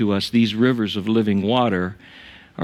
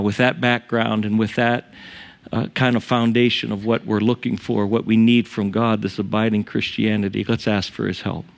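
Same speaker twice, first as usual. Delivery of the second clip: usual speaking voice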